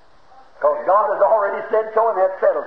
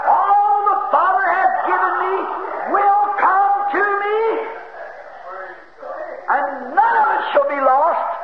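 much higher, ringing, the last words drawn out slow; rising to a shout; 7 semitones higher, slower than usual. Real, not acted. Preacher overcome, crying the verse out to the church. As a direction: let the spirit lift the verse into a cry. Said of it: "I feel like shouting on sudden".

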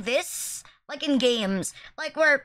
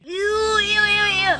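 disgusted shouting; 7 semitones higher, much slower than usual. Real, not acted. Disgusted by gross clip.

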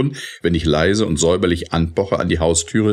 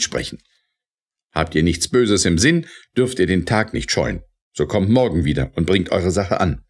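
strong and firm; 5 semitones higher than usual. A narrator's reading. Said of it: "sprach stark und fest".

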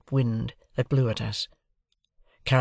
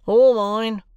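loud, high-pitched gruff retort; rough convict butting in loudly; 10 semitones higher, 8 dB louder than usual. A narrator's reading. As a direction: chime in gruffly, much louder. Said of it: "said the other gruffly".

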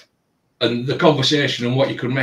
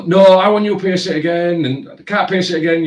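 mock demand; 7 semitones higher, 4 dB louder than usual. Customer voiced demanding again.

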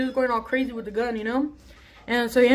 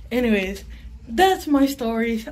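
usual speaking voice

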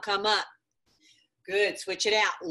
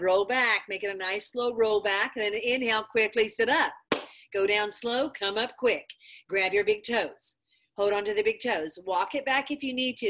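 ordinary talk

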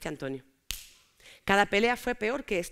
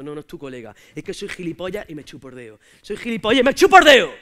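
put-on 1 boyfriend jealous; 4 semitones lower, 7 dB louder than usual. Boyfriend jealous rant voiced.

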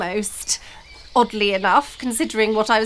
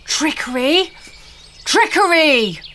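trolls' booming outcry, rising louder and higher; 4 semitones higher, 5 dB louder than usual. A narrator's reading. Other trolls crying out against Blossom, booming. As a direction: boom the accusation, harder the second time. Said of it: "boomed some of the other trolls".

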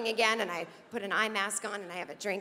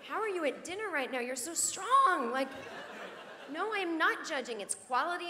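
mock sympathy; 5 semitones higher than usual. Mock condolence to friend.

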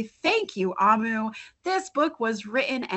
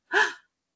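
gasp; 10 semitones higher than usual. Acted. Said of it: "What a delicious stew".

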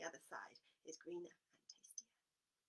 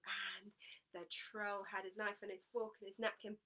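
plain reading voice

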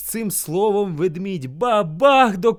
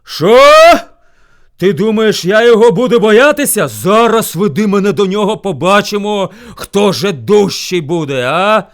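loud, indignant challenge; furious bear; 4 semitones higher, 13 dB louder than usual. A narrator's reading. Bear boasts he fears no rival, angry shout. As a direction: scorn the idea of fear. Bear outraged at hare's warning.

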